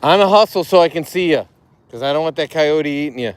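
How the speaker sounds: loud call across the street. Real, not acted. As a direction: shout so he can hear. Calling to son across street.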